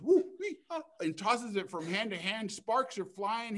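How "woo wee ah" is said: high, loud yelps of pain; hot-hands yelps. Acted. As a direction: yelp at the hot ember. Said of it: "And he goes".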